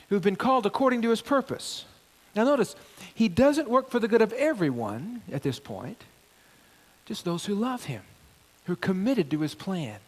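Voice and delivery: normal talking voice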